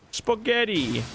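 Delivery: mock Italian mobster "Spaghetti" with a long o; lightly hammed-up wiseguy; cartoon Italian accent, "spoghetti". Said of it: "the accent and the way they speak".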